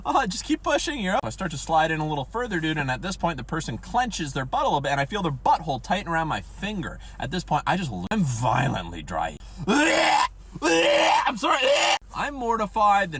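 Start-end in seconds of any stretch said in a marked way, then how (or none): 0.0-1.2 high giggly nurse voice, mocking; giggling nurse. Nurse giggling at the retching student, high and mocking. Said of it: "She's like". 9.7-11.6 high, slow, strained gagging cry; retching apology. Retold or imitated. Student gagging out an apology to the patient, high strained cry.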